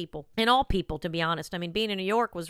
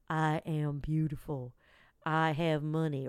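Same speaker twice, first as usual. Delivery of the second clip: slow, low, solemn affirmation narrator; mock affirmation voice; 4 semitones lower, 3 dB quieter, slower than usual. Mocking YouTube affirmations to listeners, slow solemn voice. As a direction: imitate a YouTube affirmation video. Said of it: "I do all these affirmations on YouTube".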